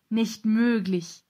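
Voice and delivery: erstaunter Ausruf. A narrator's reading. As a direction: draw it out in amazed disbelief. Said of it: "rief Emil". (ungläubig und gedehnt ausgerufen)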